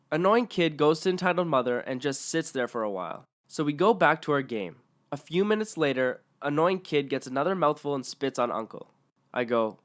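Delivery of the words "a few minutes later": ordinary delivery, nothing marked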